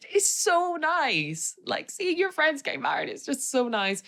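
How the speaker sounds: high, slow, tearful voice; choked up with joy, pitch rising; teary gush. Real, not acted. Tears at friends' wedding.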